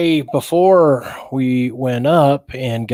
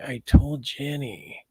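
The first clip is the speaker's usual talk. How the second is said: usual speaking voice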